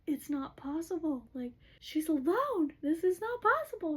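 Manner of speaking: high, rising incredulous protest; retold stunned outcry; acted disbelief. Acted disbelief of watching king.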